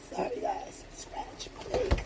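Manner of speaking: breathy whispered aside, voice gone. Real, not acted. Whispering an aside to viewers while scratching her leg. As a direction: drop to a whisper for a quick apology.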